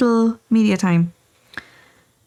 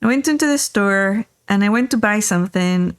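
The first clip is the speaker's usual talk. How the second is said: ordinary delivery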